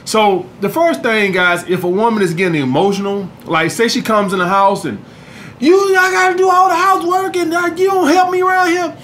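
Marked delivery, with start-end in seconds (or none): 5.6-9.0 high-pitched mock girlfriend complaining; nagging girlfriend. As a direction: whine it like a nagging girlfriend who does all the chores. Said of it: "If she's acting like that".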